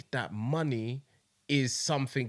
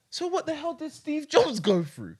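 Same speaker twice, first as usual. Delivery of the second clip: high-pitched incredulous question; 12 semitones higher, 4 dB louder than usual. Retold or imitated. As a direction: pitch it way up in retold disbelief. Retells his astonished realisation.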